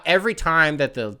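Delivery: ordinary delivery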